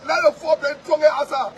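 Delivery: normal talking voice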